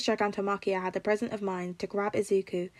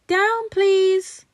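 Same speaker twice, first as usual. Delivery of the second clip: put-on very high, loud toddler voice; little boy asking; 11 semitones higher, 10 dB louder than usual. A narrator's reading. Toddler asking the giant bodyguard to put him down, very high. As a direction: ask politely like a small child.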